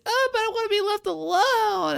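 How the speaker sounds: put-on high whiny little boy; mock whiny child voice; whiny kid. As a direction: whine it like a sulky kid. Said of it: "And he's like".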